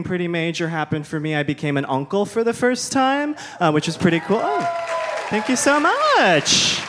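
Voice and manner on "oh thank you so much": gushing thanks. Real, not acted. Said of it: "responding to applause".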